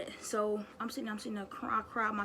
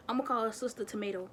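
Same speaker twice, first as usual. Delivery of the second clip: ordinary talk, nothing marked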